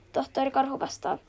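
ordinary delivery, nothing marked

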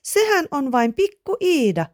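put-on high, childlike voice; high boyish storybook voice; amused young boy. A narrator's reading. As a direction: pipe it up like a giggly child. Amused boy explains after giggling.

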